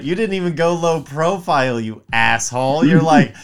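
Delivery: mock outraged scolding. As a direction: chew someone out in hammed-up indignation. Acted scolding over peanuts on plane.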